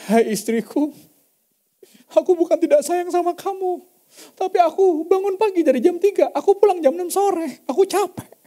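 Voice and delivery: big working man confessing to his wife, tearfully; husband's tearful plea. Retold or imitated. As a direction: plead with his wife like a tired man on the verge of tears. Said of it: "Dia bilang".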